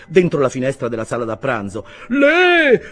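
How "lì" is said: very high, loud echoing cry; echoed shout. A narrator's reading. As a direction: yell it high, echoing the driver. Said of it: "fecero eco". (grido acuto di rimando)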